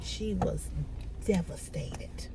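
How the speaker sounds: slow whispered devastated. Real, not acted. Describing how the friend felt, slow sad whisper. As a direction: breathe the word out slowly.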